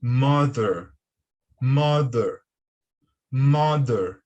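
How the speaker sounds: "mother" with a firm voiced th, like "mader"; "mother" com th sonoro, quase D; English pronunciation. Shown as a demonstration. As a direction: say "mother" with the th sounding like D. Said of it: "a pronúncia correta é".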